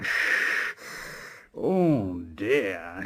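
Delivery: low, slow grumbling chant with sighing puffs; cross slow Gordon. Acted. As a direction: grumble in shame under your breath. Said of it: "very slowly and very crossly".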